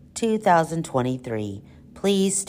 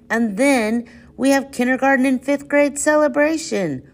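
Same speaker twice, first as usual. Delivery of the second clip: ordinary talk, nothing marked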